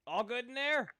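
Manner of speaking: loud call through the door. Acted. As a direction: call out loudly, checking in. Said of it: "you hear someone call out".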